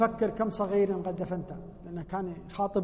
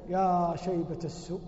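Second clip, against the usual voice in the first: self-reproach; 5 semitones lower, slower than usual. Retold or imitated. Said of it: "حتى أذن الفجر".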